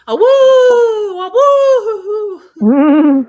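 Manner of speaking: high, loud howling like a dog. Retold or imitated. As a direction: howl like a siren-struck dog. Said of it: "he started going".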